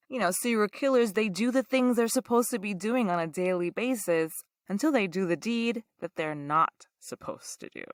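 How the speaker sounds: ordinary delivery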